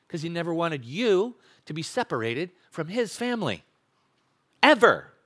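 normal talking voice

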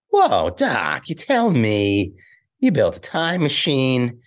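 a light Michael J. Fox Marty voice, slowed; lightly voiced young Marty, amazed; Marty impression. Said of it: "This is what I do for my act".

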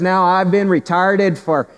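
put-on slow, drawn-out Newfoundland accent; imitated Newfoundland accent. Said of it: "that's a rich accent".

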